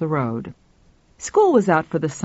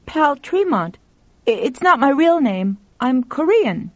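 put-on higher-pitched, hesitant boy's voice; young boy; 8 semitones higher than usual. A narrator's reading. Boy explaining his name to a stranger, high voice. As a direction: pitch it up as a child explaining himself.

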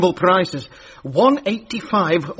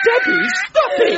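shrieked high order; exasperated shout. Acted. Boss ordering Debbie to stop laughing, exasperated shriek. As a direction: yell it, voice shooting right up.

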